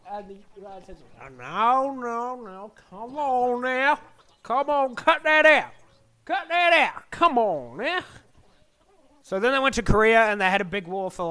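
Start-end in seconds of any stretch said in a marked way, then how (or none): 1.2-8.0 high-pitched comic scolding; whiny, escalating telling-off; mock protesting. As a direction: object like someone fed up with mischief. Acted American protest at Russians.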